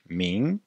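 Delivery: Ming ending on a velar ng. Shown as a demonstration. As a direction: finish Ming with a back nasal ng. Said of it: "on dit I et N G". (Ming avec un ng final)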